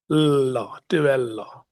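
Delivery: held l in "Lo", then "Tebello" with a double l; correct Tebello. Shown as a demonstration. Chair drilling the name, careful pronunciation. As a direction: stretch the l: "Lo, Tebello" as l-lo, Te-bel-lo. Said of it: "it's Tebello".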